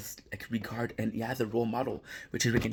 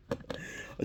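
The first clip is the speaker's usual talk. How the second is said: laugh; 10 dB quieter than usual. Real, not acted. Laughing mid-recap at the scene.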